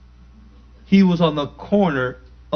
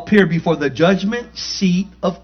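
slow, loud scripture reading; preaching; 5 semitones higher, 6 dB louder, slower than usual. Acted. Preacher calling the verse out to the congregation, loud and slow.

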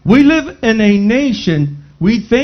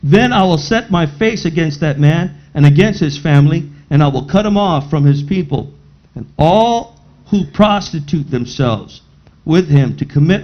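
lower, measured Bible reading; scripture reading; 6 semitones lower than usual. Acted. Preacher reads scripture aloud.

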